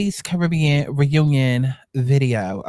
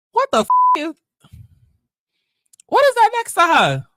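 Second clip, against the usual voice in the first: shocked squeal. Real, not acted. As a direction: shriek your disbelief at what you see. Shocked reaction to the clip.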